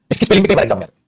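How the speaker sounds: usual speaking voice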